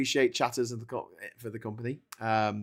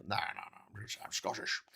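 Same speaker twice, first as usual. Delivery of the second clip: low-key, much softer Scottish refusal; Sean Connery; 3 semitones lower, 12 dB quieter than usual. Acted. Scottish star refuses to try Irish, quiet dismissive impression. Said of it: "he just sounds Scottish".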